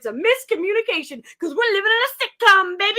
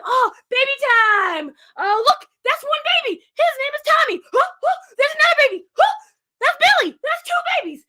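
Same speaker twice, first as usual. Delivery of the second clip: high, loud mock birth announcements; excited; 8 semitones higher, 6 dB louder than usual. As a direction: exclaim each baby in pitched-up amazement. Acting the births of the twins.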